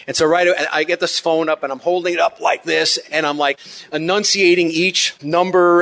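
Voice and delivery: normal talking voice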